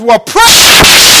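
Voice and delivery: loud high joyful cry; delighted exclamation. Retold or imitated. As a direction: burst out with delight.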